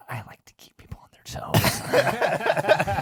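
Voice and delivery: slow, teasing stage whisper; drawn-out, exaggerated whisper for comic effect; mock whispering. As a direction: imitate a man who only ever whispers. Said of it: "refuses to talk at a normal volume".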